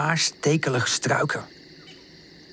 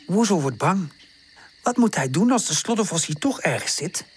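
ordinary delivery, nothing marked

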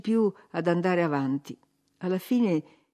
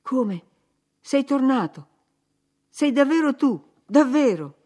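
rising, louder disbelief at a return; stunned surprise; 7 semitones higher, 6 dB louder than usual. Acted. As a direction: gasp it out as if seeing a ghost. Narrator stunned at Heathcliff's return.

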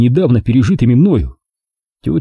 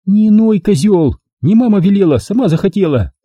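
Milka, mock-encouraging; 9 semitones higher than usual. A narrator's reading. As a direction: bark it at a whining patient. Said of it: "Периодически подбадривала она".

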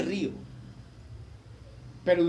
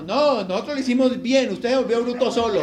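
mock father's retort; 4 semitones higher, 7 dB louder than usual. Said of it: "Entonces él llegó y me dijo".

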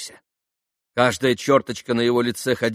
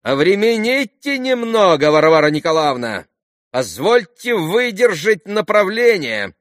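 high, loud, stretched-out command; father's high-pitched peremptory cry; commanding shout; 10 semitones higher, 7 dB louder than usual. A narrator's reading. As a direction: call across to hush her imperiously. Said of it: "крикнул ей отец, хотя и повелительным тоном".

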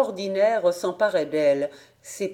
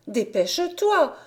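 impatient urging; 8 semitones higher than usual. A narrator's reading. Urging brother to read the letter.

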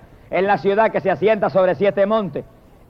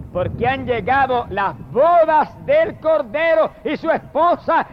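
higher, slow, word-by-word announcement; ringing proclamation; 5 semitones higher than usual. Retold or imitated. Preacher announces the Lamb's wedding from scripture, slow rising proclamation. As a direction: build it higher toward the last phrase.

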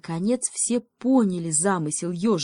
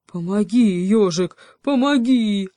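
loud plea, rising higher at the end; desperate cry; 4 semitones higher, 7 dB louder than usual. A narrator's reading. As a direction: cry out for help in panic. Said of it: "с криком бросился к ёжику". (отчаянный крик о помощи)

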